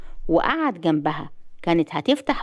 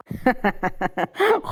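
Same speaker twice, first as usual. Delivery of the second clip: short burst of laughter; amused laugh; 4 semitones higher than usual. Acted. Husband tickled by his wife's objection, laughing. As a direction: laugh at her jealousy.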